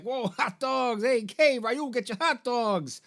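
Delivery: high, slow hawker's call; hammed-up vendor pitch; hot dog vendor. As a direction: sing out hot dogs like a street vendor. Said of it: "He's like".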